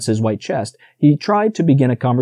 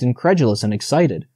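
ordinary delivery, nothing marked

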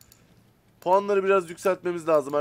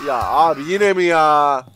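loud, very slow drawn-out groan of disbelief; exasperated groan; 3 semitones lower, 8 dB louder, slower than usual. Real, not acted. Gamer groaning because the same trouble happens again, slow exasperated moan. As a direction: stretch it out in disbelief, fed up.

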